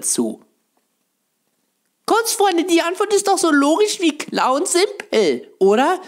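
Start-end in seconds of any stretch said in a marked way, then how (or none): 2.1-6.0 mocking know-it-all voice. Mock answer to fan question.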